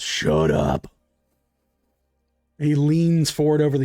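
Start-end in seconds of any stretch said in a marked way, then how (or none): 0.0-0.9 deep monster voice. Acted. Monster answers the human.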